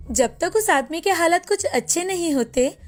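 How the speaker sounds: usual speaking voice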